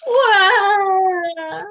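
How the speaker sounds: high, loud mock bawling; a storybook horse wailing for children; hammed-up wah cry. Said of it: "And he says".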